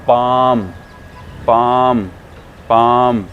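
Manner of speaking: पाम loud, with a held "aa"; loud, separate model takes of पाम, long vowel; long aa sound. Shown as a demonstration. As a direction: stretch पाम into "paaam". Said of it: "थोड़ी सी long sound के साथ".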